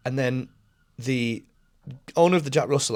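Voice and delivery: ordinary talk, nothing marked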